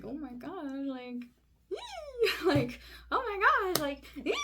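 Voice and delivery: squealing with joy, very high on ee; excited squeal. Retold or imitated. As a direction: let out a giddy high-pitched squeal. Joy at approval.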